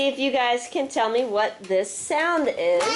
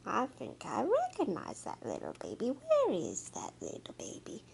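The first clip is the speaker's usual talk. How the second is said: much softer, quiet searching voice; hushed searching; 3 semitones lower, 10 dB quieter than usual. Acted. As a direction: hunt for the cub in a hush. Mother jaguar searching softly.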